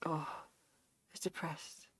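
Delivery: very quiet, deflated sigh of a dog; softly hammed-up gloom; mock depressed dog. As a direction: imitate a dejected dog, barely audible. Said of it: "it's depressed, like, not happy".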